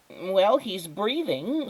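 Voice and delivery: high, slow, fussy neighbour voice; a neighbour checking the squirrel, high and slow; Mrs Tickum's voice. Acted. Neighbour checks on the fallen squirrel, voice pitched high and slow.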